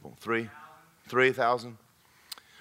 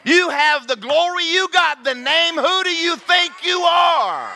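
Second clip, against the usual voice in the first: high, drawn-out full-throated bellow; slow, very loud preaching holler; indignant shout; 9 dB louder, slower than usual. Acted. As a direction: roar the challenge at the congregation, building to the last word.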